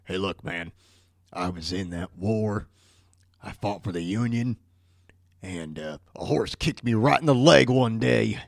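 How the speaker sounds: low, soft, mumbling soldier's excuse; whisper-quiet con man spinning a tall tale; imagined Booth excuse. Retold or imitated. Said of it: "I wonder if he said".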